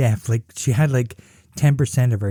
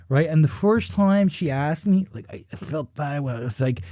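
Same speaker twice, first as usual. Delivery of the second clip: ordinary talk, nothing marked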